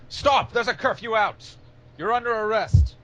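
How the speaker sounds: high, loud soldier's shout; yelled order. Acted. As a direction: yell it at someone running away. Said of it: "they yell out at you".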